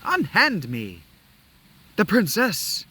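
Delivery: high, loud defiant princess. Acted. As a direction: cry out like an indignant princess. Voicing the princess in cutscene.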